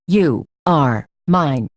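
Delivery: "You", "are", "mine" each set apart, very slow; understated snarl, stressing "You are mine" word by word; each word emphasized. A narrator's reading. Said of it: "he snarls, emphasizing each word".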